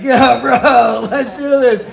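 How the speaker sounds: loud, high, laughing stoner bro; stoned roommate. Retold or imitated. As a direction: pump it up like a blazed roommate. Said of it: "my roommate's like".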